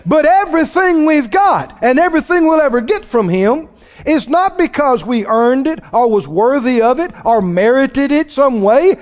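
ordinary talk, nothing marked

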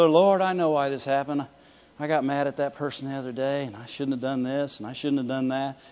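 low, soft self-blame; hushed, low-pitched guilty admission; mock confession. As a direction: imitate a sheepish prayer of regret, murmured and running on. Imitating a self-blaming confession.

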